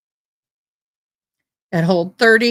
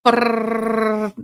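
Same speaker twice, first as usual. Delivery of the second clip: rapid-fire gun noise. Retold or imitated. Imitating rapid gunfire.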